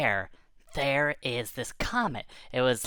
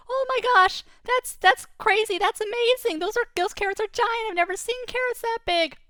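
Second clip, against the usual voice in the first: very high, loud, squeaky amazement; a rabbit overjoyed by giant carrots; thrilled rabbit; 4 dB louder than usual. Acted. Rabbit marveling at the giant carrots, squeaky and thrilled. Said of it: "And he says".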